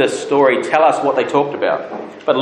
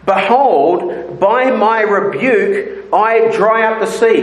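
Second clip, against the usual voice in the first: God's declaration; 9 semitones higher than usual. Acted. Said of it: "he says".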